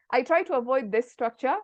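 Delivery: normal talking voice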